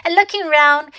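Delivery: ordinary delivery, nothing marked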